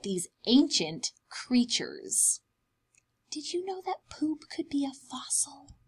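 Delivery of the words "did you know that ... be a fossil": whisper. Acted. Secret aside to young listeners.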